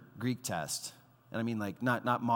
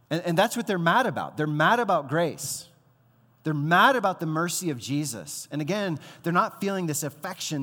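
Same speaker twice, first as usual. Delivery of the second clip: loud, hammering stress on mad; preaching climax; 6 semitones higher, 8 dB louder than usual. Real, not acted. Pastor pressing the crowd about grace, forceful preaching. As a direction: drive each line down hard on mad.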